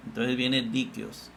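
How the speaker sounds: usual speaking voice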